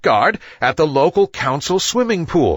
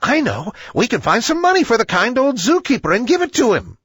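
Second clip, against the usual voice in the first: much higher, fast and eager bat voice; piping bat; 9 semitones higher, faster than usual. A narrator's reading. The bat Billy Big Ears blurts his idea to the meeting, high and fast. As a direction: pipe up in a rush with a bright idea. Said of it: "piped up".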